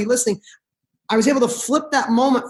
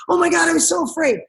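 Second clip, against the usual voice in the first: high-pitched excited kid impression; imitating proud son; 8 semitones higher, faster than usual. Son retelling his bungee jump, imitated proud excitement. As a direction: gush like a little boy reliving his big scare. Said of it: "the most proud little guy".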